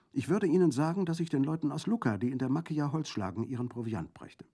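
high, bright-voiced little girl answering readily; a quick-witted village child, unafraid; young girl. A narrator's reading. Girl's voice in dialogue.

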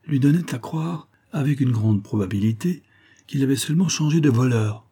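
plain reading voice

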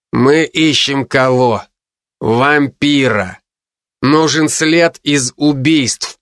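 slow, patient explaining. Acted. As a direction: spell it out slowly to a junior. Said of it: "терпеливо произнёс мужчина". (терпеливо и медленно)

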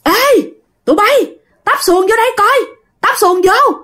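high-pitched hail to the boatmen; calling out. A narrator's reading. Grandfather orders boats to pull in, high calling voice. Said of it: "ông ngoại mới kêu".

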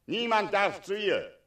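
commanding shout. Acted. Dragon ordering Heinrich to guard the girl, loud.

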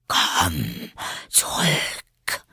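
drawn-out, breathy call; sleepy caterpillar calling. A narrator's reading. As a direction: call after her drowsily. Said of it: "rief ihr die Raupe nach". (schläfrig gedehntes Nachrufen)

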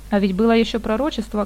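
ordinary delivery, nothing marked

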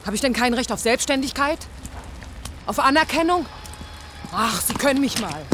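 defiant and furious, climbing high; furious outburst. Acted. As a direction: fight for recognition, voice cracking up. Furious argument with the boss.

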